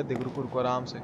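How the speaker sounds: ordinary talk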